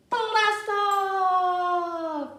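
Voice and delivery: big high shout of blast off. Shown as a demonstration. Teacher shouting the launch for the kids. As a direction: yell it like the rocket launches. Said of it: "we're gonna blast off".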